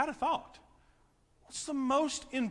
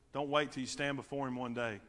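usual speaking voice

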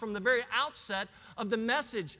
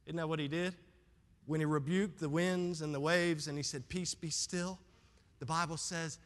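calm, hushed preaching; 7 semitones lower, 5 dB quieter than usual. Acted. Calms voice describing the great calm.

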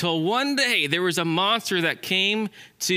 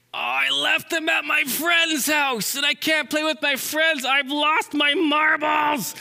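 high, slow, drawn-out wail; frantic wailing squirrel; 8 semitones higher, slower than usual. Acted. Squirrel can't find his marbles, high wailing meltdown.

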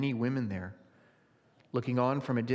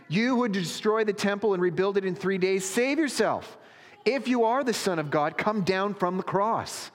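high, raised taunting voice; derisive jeering; 7 semitones higher, 4 dB louder than usual. A narrator's reading. Passers-by deriding the crucified man, high raised jeer. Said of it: "those who passed by derided him".

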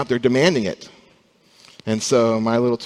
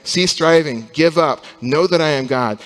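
louder, commanding voice of God to the nations; God's stern command; 4 semitones higher, 5 dB louder than usual. Acted. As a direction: proclaim it as an order to give up. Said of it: "It's a message to those nations saying".

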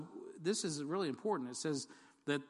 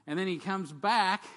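much louder, slow, proclaiming "comes back"; big triumphant return; 5 semitones higher, 10 dB louder than usual. Shown as a demonstration. Preacher proclaiming Christ's return to the congregation, loud and slow. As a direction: announce his return like a herald. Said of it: "some of you think that was funny".

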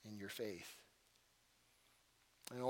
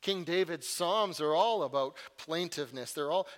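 usual speaking voice

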